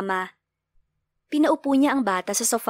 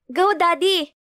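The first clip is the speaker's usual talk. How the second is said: put-on high, louder little kid cheer; child cheering; 7 semitones higher, 3 dB louder, slower than usual. A narrator's reading. Child cheering father on to hug mother. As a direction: cheer him on like a small child. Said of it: "Nag-cheer pa ito".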